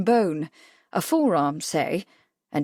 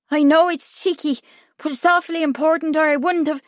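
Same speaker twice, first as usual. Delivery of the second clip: put-on high, pressed boy's voice; flustered boy; 8 semitones higher, 4 dB louder than usual. A narrator's reading. Irish boy excusing his intrusion, high and flustered. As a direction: hurry out a high, cheeky apology.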